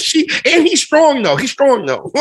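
high-pitched imitation of a younger self; puzzled kid. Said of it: "I was a kid".

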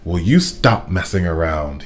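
plain reading voice

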